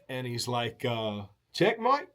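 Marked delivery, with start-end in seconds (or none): 1.5-2.1 higher, rising mock Aussie question; puzzled Aussie. Aussie host puzzled when the guest asks for the check, higher mock Aussie. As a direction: sound baffled by the word.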